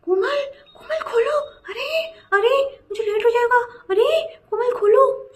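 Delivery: pleading cry of kholo through the door; frantic shouting. Retold or imitated. Roommate banging on the bathroom door, shouting in frantic distress. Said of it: "जोर जोर से दरवाज़ा पीट रही है".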